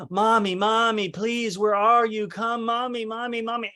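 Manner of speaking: put-on high, pleading little-kid voice; imitated clingy child. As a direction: beg for mommy like a needy child. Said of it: "he wanted his mom".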